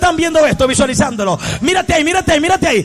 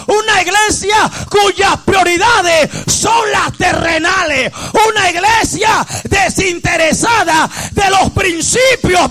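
full-force preaching; 4 semitones higher than usual. Real, not acted. Preacher rebuking a worldly church, full-force preaching. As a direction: cry it out phrase by phrase.

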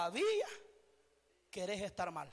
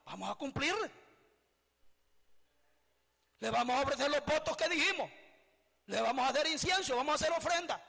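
angry vow; 3 semitones higher than usual. Acted. Rebellious people shouting their vow to the queen of heaven, angrily. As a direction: hurl the vow back in anger. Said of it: "salieron enojados".

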